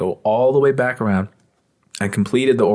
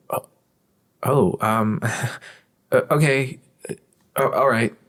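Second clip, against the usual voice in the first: flustered customer; 4 semitones higher than usual. Retold or imitated. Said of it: "they would act like anime characters".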